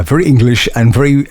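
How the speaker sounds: ordinary delivery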